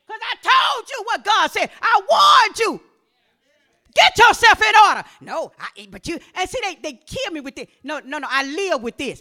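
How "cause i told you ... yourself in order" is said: high, shouted rebuke; forceful preaching. Real, not acted. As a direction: thunder it at the congregation.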